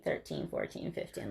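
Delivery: whispered counting, soft and casual. Retold or imitated. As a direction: whisper the numbers offhandedly. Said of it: "And Kelly's like".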